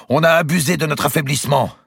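high, loud accusation; indignant protest. A narrator's reading. Second Templar turns on the archbishop, indignant protest. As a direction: call it out with force.

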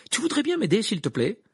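high, loud, urgent request; exasperated demand. A narrator's reading. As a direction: snap out a pointed plea for help. Furious Harry demanding Krum's help.

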